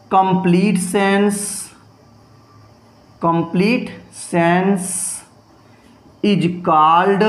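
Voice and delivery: very slow, pausing between phrases; slow dictation. Shown as a demonstration. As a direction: read it out for copying. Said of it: "आप यहाँ लिख देंगे". (धीमे लिखवाना)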